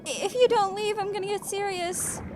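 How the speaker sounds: high, loud villain threat; squeaky little villain warning. Acted.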